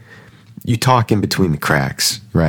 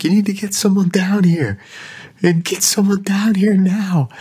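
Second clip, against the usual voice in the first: much higher, frantic demand; panicked boss on the phone; 13 semitones higher than usual. Retold or imitated. Boss on the phone freaking out about the flood, panicked high voice. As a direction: squeal it out like a boss losing it. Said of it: "he's freaking out".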